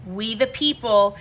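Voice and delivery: ordinary delivery, nothing marked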